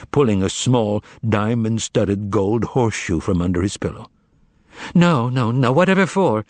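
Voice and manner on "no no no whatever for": surprised, higher refusal; flustered protest. A narrator's reading. Margarita refusing a gift.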